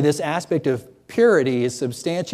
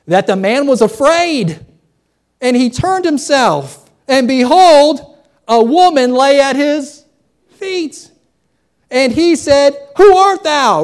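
loud, high, full-force preaching delivery; dramatic scripture reading; 11 semitones higher, 10 dB louder, slower than usual. A narrator's reading. Preacher reading the midnight discovery to the congregation, loud and dramatic. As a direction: build the verse up to a startled cry.

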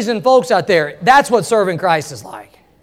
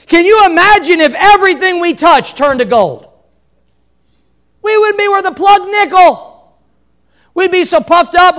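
preaching at full force; 9 semitones higher, 6 dB louder than usual. Real, not acted. Pastor drives home the point about trials, preaching loud. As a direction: preach it out over the congregation.

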